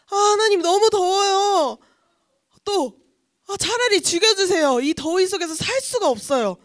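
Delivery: high, loud acted wail, stretched at first; whiny angry complaint. Jonah complains to God about the heat, whiny anger. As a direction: whine in exasperation, easing slightly by the end. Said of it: "화를 내기 시작합니다".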